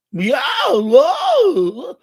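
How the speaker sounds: high, loud, drawn-out whoa; astonished shout. Retold or imitated. Ex-boyfriend hears her new Valley girl accent, astonished shout. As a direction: exclaim in exaggerated shock. Startled by ex-girlfriend's changed accent.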